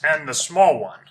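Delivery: loud, booming lumbering giant; dopey cartoon bellow. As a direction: bellow it like a big friendly giant. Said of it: "He says".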